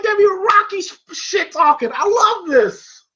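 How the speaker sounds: high, thrilled fan reaction; real excitement. Real, not acted. Fan loving Rocky's trash talk, excited. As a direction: gush with delight, stretching the last words.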